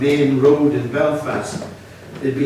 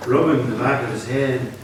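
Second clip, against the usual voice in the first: plain reading voice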